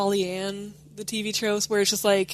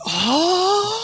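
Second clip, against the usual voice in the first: a high, louder startled "Oh"; mock surprised gasp; 10 semitones higher, 4 dB louder than usual. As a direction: gasp it out like a shy girl caught off guard. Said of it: "Togo's like".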